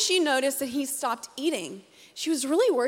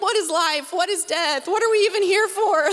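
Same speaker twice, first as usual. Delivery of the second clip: higher, louder, building mock panic; a small boy's sudden big questions; mock existential panic; 6 semitones higher, 4 dB louder than usual. Mother voicing her son's existential crisis, higher and louder. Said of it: "his four-year-old brain is like".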